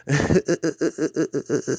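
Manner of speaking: high stuttering "huh-huh-huh" laugh; crazy Butthead laugh. Retold or imitated. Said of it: "If you laugh one more time".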